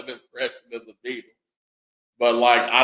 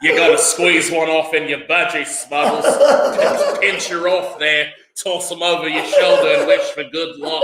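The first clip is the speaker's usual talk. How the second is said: higher-pitched Australian bloke explaining; Australian accent; 5 semitones higher than usual. Acted. Mock-explaining slang to friends as an Australian, in Australian accent. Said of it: "explain it like they would".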